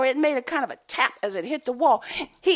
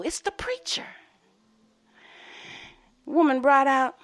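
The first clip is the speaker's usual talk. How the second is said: plain reading voice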